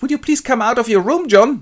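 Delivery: high, loud pleading; desperate plea. Acted. Bernard begs John to come out, desperate high plea. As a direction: plead desperately through a closed door. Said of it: "Bernard rather desperately knocks on his door".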